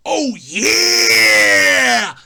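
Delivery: gravelly wrestler roar, loud and low; booming Oh yeah catchphrase; Macho Man impression. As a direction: growl it out like a pro wrestler promo. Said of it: "That's the one that goes".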